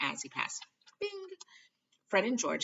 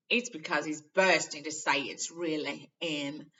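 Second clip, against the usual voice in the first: knowingly; 7 dB louder, slower than usual. A narrator's reading. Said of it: "said Ron knowingly".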